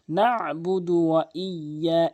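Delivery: "নাবুদু ওয়া ইয়া" slow, throaty ain, doubled y; correct recitation. Shown as a demonstration. Teacher models correctly, "নাবুদু" with pressed ain. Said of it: "চাপ দিয়ে".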